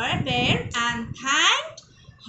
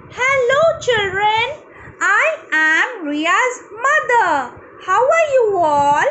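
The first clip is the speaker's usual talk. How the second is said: higher, louder storybook mother; Ria's mother; 6 semitones higher, 5 dB louder than usual. Acted. Ria's mother greets the children, high hearty voice. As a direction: greet the children in a bright, projected character voice. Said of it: "and this is Ria's mother".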